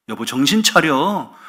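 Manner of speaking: loud, drawn-out acted rebuke; scolding husband. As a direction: tell your wife off, stretching the words. Husband repeats his scolding. (정신 차리라고 길게 호통치는 남편 연기)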